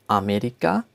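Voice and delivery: "Amerika" in German, clear 'ah' at start and end, rising; German-style "Amerika", lifted at the end; German pronunciation. Shown as a demonstration. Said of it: "In German, we say Amerika".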